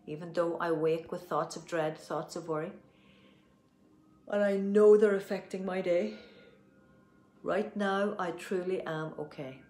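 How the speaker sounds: firm, earnest affirmation with a loud swell on know; tapping affirmation. Acted. Coach leading viewers through a tapping affirmation, earnest and firm. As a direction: speak the affirmation with conviction, slowing at the end.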